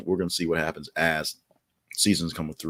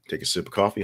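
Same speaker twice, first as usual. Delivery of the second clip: ordinary talk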